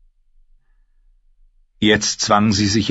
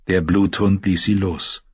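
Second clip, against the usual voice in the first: ordinary delivery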